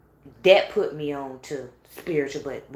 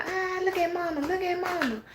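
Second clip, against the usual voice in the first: put-on much higher teasing kid voice; sing-song taunt like kids pointing and giggling; mocking children; 12 semitones higher than usual. Children laughing at their freaked-out mother, teasing. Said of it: "they sitting there laughing".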